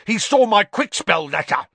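high, loud accusing outburst; frenzied snarling cry. A narrator's reading. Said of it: "snarled Filch".

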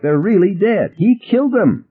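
high, strained outcry; frantic, pitch climbing sharply; desperate insistence. A narrator's reading. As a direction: plead with them to believe it. Ted desperately insists on the killings.

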